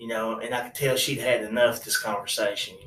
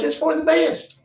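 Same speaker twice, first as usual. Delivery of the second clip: high and louder, upbeat; cheery uncle; 11 semitones higher, 7 dB louder than usual. Retold or imitated. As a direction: cheer up, time to party.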